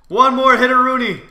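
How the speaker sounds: high, loud mid-fight yell; excited full-voice shout; urgent shout. Real, not acted. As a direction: holler it while chasing the kill. Shouting while chasing an opponent.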